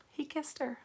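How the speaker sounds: gasp, then a high hushed reread; mock shock. A narrator's reading. Reader mock-shocked at the kiss.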